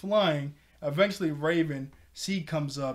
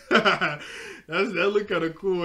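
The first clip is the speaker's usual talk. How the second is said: louder, laughing through the words; 3 semitones higher, 7 dB louder than usual. Real, not acted. Admiring the dark energy scene, laughing delight. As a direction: giggle while you say it.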